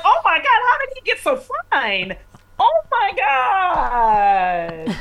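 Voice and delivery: high, slow swoon. Pretend. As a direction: gush it out slowly, pitched high. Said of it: "And you're like".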